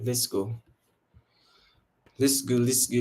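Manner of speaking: ordinary talk, nothing marked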